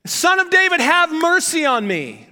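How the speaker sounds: high, loud pleading cry; shouting. Acted. Beggar crying out to Jesus for mercy. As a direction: beg him at full voice. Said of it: "he began to shout".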